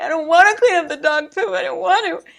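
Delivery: high, loud whiny mock wail; hammed-up weepy protest; mock crying. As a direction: whine it like a tearful little tantrum. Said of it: "I cry".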